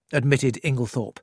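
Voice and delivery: ordinary delivery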